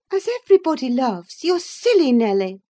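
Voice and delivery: high, quick scoff; impatient high-voiced young lady; Catherine. A narrator's reading. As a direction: toss it off high, loud and fast.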